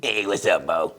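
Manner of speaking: very low, robotic-sounding greeting; low Bomani Jones impression. Guest greeting Bo on the show, low droning impression. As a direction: greet him far down in your range. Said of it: "Sound like Stephen Hawking".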